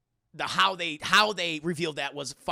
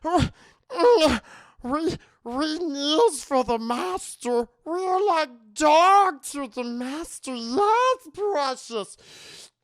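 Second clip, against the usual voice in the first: slow, high, louder creature voice; slow, pitched-up Gollum imitation; 8 semitones higher, 7 dB louder, slower than usual. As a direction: fawn over the master like Gollum. Gollum Girl groveling to master.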